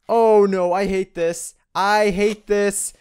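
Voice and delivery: loud scared complaint; panicked, hating the dark; scared horror game streamer. Real, not acted.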